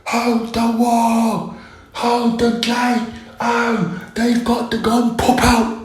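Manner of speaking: high, slow, hammed-up shouts to hold the gate; mock battle yell. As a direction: yell orders like a soldier at the wall. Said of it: "You're like".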